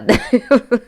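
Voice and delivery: real laugh breaking into the talk. Real, not acted. Vlogger cracking up over a bride's earrings, laughing.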